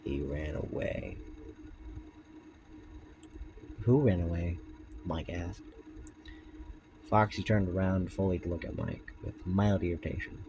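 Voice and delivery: low, soft story reading; story narration. A narrator's reading. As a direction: read the tale in a hushed voice. Reads Foxy story aloud.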